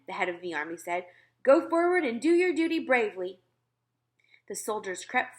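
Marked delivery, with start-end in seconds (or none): 1.4-3.4 higher, louder shout of command; commander's order. Acted. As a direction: bark the order at your men. Head of army urges soldiers.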